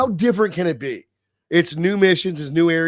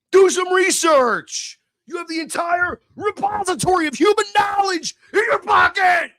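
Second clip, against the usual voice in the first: frustrated shout; 11 semitones higher, 5 dB louder than usual. Real, not acted. Ranting at people who don't look things up, frustrated shout. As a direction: vent the frustration at the top of the voice.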